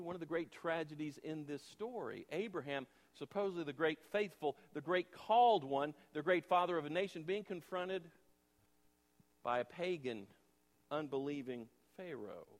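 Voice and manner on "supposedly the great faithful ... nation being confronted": usual speaking voice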